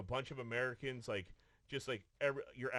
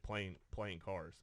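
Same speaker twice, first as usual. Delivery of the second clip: ordinary talk, nothing marked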